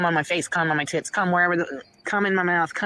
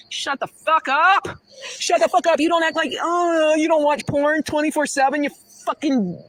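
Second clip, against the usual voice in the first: high, loud indignant outburst; angry rant; 8 semitones higher, 4 dB louder than usual. Acted. Woman telling off the man who denies watching porn, angry. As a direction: yell it high, as if telling him off. Ranting retort in retold scene.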